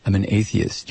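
low, subdued reply to the officer; questioned passenger. Acted. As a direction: answer meekly in a low monotone.

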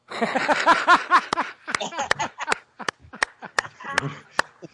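high, long rattling laugh; loud cackle. Real, not acted. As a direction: crack up at your own joke. Big laugh after own punchline.

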